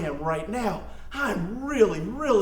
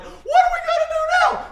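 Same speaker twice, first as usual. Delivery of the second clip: high, loud frantic cry; frightened Israelites crying out as snakes bite; panicked cry; 5 dB louder than usual. Acted. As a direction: shriek it like the snakes are at your feet. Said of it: "They're like".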